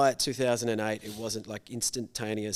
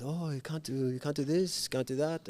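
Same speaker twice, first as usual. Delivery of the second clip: put-on Thai accent; 5 semitones higher than usual. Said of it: "I just gotta do his accent".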